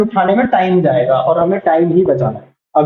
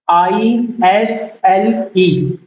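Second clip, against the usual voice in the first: spelled out slowly; much slower than usual. Shown as a demonstration. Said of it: "यहाँ पे एस का sound नहीं है".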